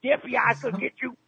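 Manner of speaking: high-pitched, strained prank-call threat; mock vigilante threat. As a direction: rant high like a cartoonish avenger. Prank caller threatens in raised voice.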